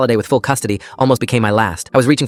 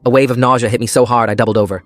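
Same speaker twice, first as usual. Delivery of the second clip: plain reading voice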